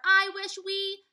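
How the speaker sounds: slow, high and loud wishing; dreamy wish. A narrator's reading. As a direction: draw out the wish. Annie starts the wish.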